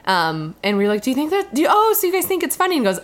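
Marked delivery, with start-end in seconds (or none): 1.0-2.8 high, quick retold double-take; surprised question. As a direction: ask it fast in a raised, astonished voice.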